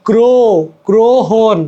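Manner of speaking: গ্রো alone, then গ্রহণ as "gro-hon"; pronunciation breakdown. Shown as a demonstration. Teacher showing the sound of ro-fola, গ্রো then গ্রহণ. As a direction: isolate গ্রো before saying the whole গ্রহণ. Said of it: "উচ্চারণ সময় কি".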